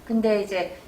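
ordinary delivery

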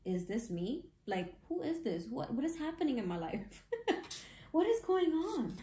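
rising into high, amazed disbelief; mock mind-boggled. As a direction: play the stunned lucky one. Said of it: "You're gonna be like".

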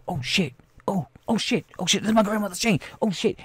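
high, softened fretting; mock dismay. Fighter missing his grandmother's chain, mildly hammed-up dismay. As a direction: act out a small panic. Acts victim losing a chain.

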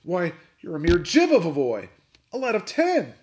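high, loud, scornful outburst; bellowed. A narrator's reading. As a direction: bellow in angry disbelief. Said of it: "he bellowed".

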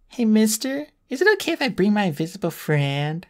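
put-on high little-girl voice; sweet and childish; cutesy voice. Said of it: "her best cutesy voice".

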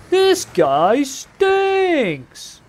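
very high, hugely stretched mock wail; drawn-out wail. Real, not acted. Gamer jeering at this guy, high drawn-out wail. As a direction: sing it out high, dragging every word. Mocking a rival player.

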